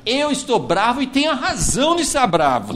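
lightly acted defiant complaint; frank angry reply. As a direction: say it straight to God, mildly worked up. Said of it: "essa franqueza com Deus".

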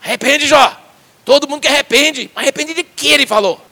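high, loud demand to repent; friends scolding. Retold or imitated. The friends demanding Job repent, loud and high. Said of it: "Os amigos".

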